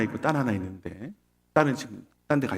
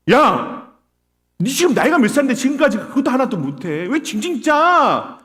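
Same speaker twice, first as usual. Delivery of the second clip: loud, high mock berating; drawn-out, yelled final jab in mock exasperation; harsh scolding; 10 semitones higher, 6 dB louder than usual. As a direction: snap at a whiny child, exaggerated. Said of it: "이렇게 하시겠냐고요".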